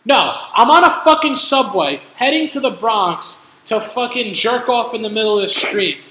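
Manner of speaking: loud, high, sarcastic outburst; exasperated rant. Retold or imitated. Fed-up rider answering a stranger's obvious question, exasperated rant. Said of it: "I was getting so mad".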